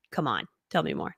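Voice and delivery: low, soft, jokey aside; mock coaxing. Seller jokingly answering grateful buyers, low coaxing. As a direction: play it cool, low and quiet. Jokey acted reply to praise.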